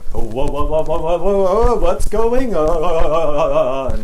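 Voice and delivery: loud, slow startled whoas; alarmed. A narrator's reading. Boys feeling the ground quake, loud and alarmed. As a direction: shaken by the quake. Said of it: "said two of the boys".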